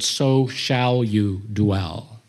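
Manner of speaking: slow, stress on So; reading "So shall you dwell" slowly with weight on "So"; slow emphatic reading. Shown as a demonstration. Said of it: "So let's read it that way".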